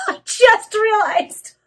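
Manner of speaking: high, slow words through laughter; laughing realization. Real, not acted. Reader has just got the pun, laughing hard. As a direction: squeal it out while laughing.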